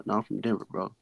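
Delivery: usual speaking voice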